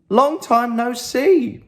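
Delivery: warm greeting. Acted. Mum greets her dad.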